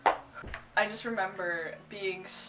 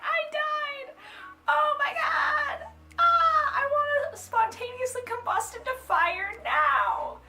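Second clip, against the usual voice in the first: much higher, louder mock-dramatic wail; hammed-up wailing yell; 7 dB louder, slower than usual. Cosplayer overplaying her dead character, high mock wail. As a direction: wail it like a melodrama ghost.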